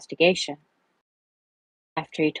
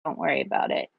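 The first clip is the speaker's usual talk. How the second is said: low, sighed brush-off; resigned sigh waving the apology away; sighed reassurance; 4 dB quieter, faster than usual. Acted. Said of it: "David sighed".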